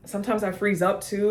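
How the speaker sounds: usual speaking voice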